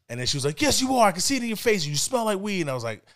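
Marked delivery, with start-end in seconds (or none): 0.6-2.6 high-pitched scolding that eases slightly; insistent mom. Retold or imitated. Mom contradicts her son's denial, accusing. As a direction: imitate a mother laying out the evidence.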